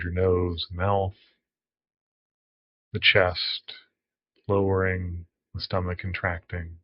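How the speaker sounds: calm meditation guide with long pauses; guided meditation. Acted. Counselor guiding listeners into a body scan, calm guided meditation. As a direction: ease them into the breath, unhurried and even.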